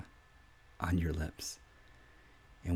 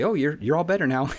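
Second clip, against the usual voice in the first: higher, louder dismissive cheer; mock breezy reassurance; 7 semitones higher, 6 dB louder than usual. Mocking someone who says the wounded are fixed, sarcastic. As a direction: brush it off sarcastically. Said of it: "and say".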